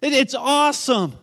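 high, loud jubilant cries; triumphant cheering, punched out word by word; excited crowd. Acted. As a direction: exclaim it like an overjoyed worshipper. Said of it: "They're so excited".

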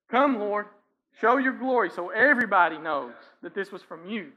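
loud, confident call on the Lord; imitated bold prayer. Hezekiah calling out to the Lord before the Assyrians, loudly. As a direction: cry out boldly for God to show his glory. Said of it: "he's saying".

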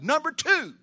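high, loud heading; shouted point number. Real, not acted. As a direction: call out the next point. Preacher announces second point.

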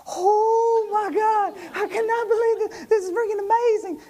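very high, wailing squeal of amazement; hammed-up giddy outburst, voice way up; ecstatic disbelief. Passenger suddenly on top of the hill, high ecstatic squeal. Retells amazed reaction at the hilltop.

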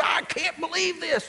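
high, disbelieving outburst; a captain who can't believe it, pitched high; mock exasperation; irate captain. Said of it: "The captain was irate".

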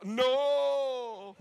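high, drawn-out shout; mock stubborn refusal. People who need help refusing it, exaggerated shout. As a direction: wail it in imitation of the freaked-out wife. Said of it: "like her, we need help, and we go".

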